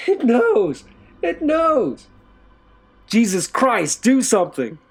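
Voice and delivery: very high, loud screaming in terror. A narrator's reading. Sobbing man screaming at the guard, terrified. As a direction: scream for help in panic. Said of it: "The sobbing man said with terror".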